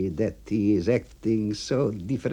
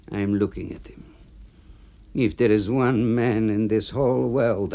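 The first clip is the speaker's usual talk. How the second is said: ordinary delivery, nothing marked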